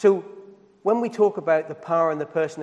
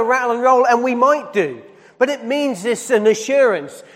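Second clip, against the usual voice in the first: louder, rising preaching voice; full-force preaching; 6 semitones higher, 5 dB louder than usual. Acted.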